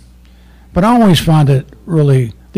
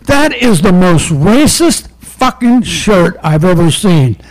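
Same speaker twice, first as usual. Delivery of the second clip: loud, slow, higher indignant voice; outraged suspect; 5 semitones higher, 5 dB louder, slower than usual. Retold or imitated. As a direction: lean in, scandalized. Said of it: "he said".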